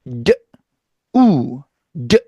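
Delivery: 드 and 우 said separately; d then oo; sounds apart. Shown as a demonstration. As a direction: split d and oo apart. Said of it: "따로따로 발음해서 나열".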